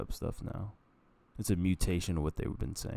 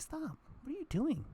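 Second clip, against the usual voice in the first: much higher, softer startled scold; squeaky alarm; 3 dB quieter than usual. Retold or imitated. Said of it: "and I was like".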